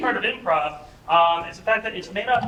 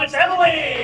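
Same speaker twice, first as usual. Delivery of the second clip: louder and higher, stretched out like a game-show intro; hype announcer; 6 semitones higher, 5 dB louder than usual. Acted. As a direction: call the name out to a cheering room. Announcing performers.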